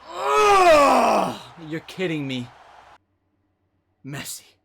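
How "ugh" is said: loud, high groan of dismay; big exasperated "ugh"; frustrated groan. Real, not acted. Frustrated at a bad pack pull.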